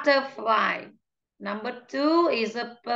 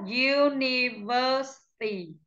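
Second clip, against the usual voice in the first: slow, drawn-out "University"; slow model pronunciation; 2 semitones higher than usual. Shown as a demonstration. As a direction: stretch "University" syllable by syllable. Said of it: "đánh trọng âm cho cô". (đọc chậm "University" từng âm tiết)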